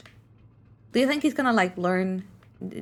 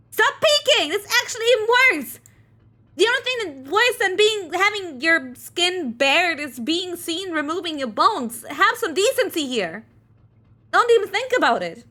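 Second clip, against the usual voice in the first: much higher and louder, prim outrage; high scandalized character; 9 semitones higher, 4 dB louder than usual. Acted. Character scolding onlookers for peeking, high and loud. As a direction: scold them like a shocked prude.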